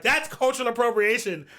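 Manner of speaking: loud, high protest; hammed-up indignant accusation; mock outrage. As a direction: call it out like an offended crowd.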